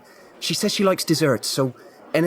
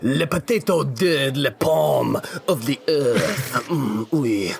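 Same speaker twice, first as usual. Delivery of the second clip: mock French accent; 2 semitones lower, much slower than usual. Mock French dish name.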